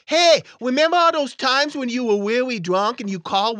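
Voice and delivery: put-on a bratty boy rubbing it in; dumb kid voice. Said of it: "this dumb voice".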